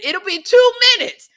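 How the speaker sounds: high, loud mock quote of a friend; high mock imitation. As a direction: squeak it out, mocking her promise. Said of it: "She said".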